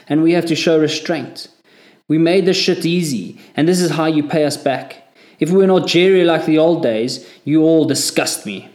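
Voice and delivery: high, loud raging rant; furious tirade. A narrator's reading. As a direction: tear into the whole section. Corporal rages at the section.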